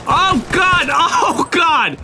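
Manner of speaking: high-pitched real panic; panicked yelling. Real, not acted. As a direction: cry out under sudden attack. Attacked at close range.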